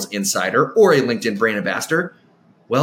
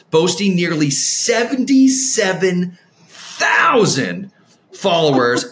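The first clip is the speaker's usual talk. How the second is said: hyped up; 6 semitones higher, slower than usual. Acted. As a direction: build it up like a big reveal. Hyping guest's follower count.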